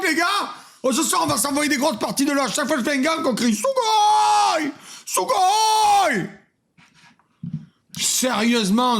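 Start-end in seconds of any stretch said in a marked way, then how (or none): 3.6-6.3 a streamer hyped on a new word, shouting; excited shout. Pretend. Streamer shouting a new Japanese word, excited. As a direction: yell Sugoi at the top of your voice, high. Imagines crowd chanting Sugoi.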